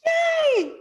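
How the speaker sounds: very high, loud celebratory cheer; happy cheer. Real, not acted. Bride cheering her bridesmaids' yes, happy cheer. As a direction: let out a high whoop of joy.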